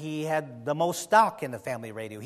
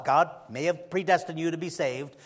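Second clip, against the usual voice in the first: ordinary talk, nothing marked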